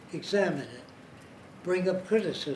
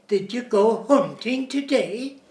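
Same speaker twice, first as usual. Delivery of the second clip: put-on slow, lilting Swedish accent, higher and louder; Swedish rhythm; 6 semitones higher, 8 dB louder than usual. Lecturer imitating Swedish sing-song rhythm, Swedish accent. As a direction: lilt the question like a Swede. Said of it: "They have a rhythm".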